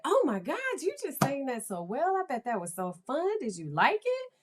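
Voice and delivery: put-on high, gushing praise for a child; sweet, lilting mom voice; delighted parent. Shown as a demonstration. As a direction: coo it with encouraging enthusiasm. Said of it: "And I'll be like".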